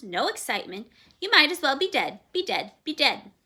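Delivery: loud, repeating parrot chatter. A narrator's reading. Parrot teasing the fearful girl, loud chatter. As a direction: rattle it off like a bossy parrot.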